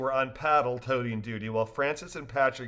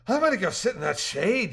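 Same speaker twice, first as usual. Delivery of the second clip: higher, louder lively dialogue; cheerful characters; 6 semitones higher, 4 dB louder than usual. A narrator's reading. Rafters joking after the trip, higher and louder. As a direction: voice the rafters brightly.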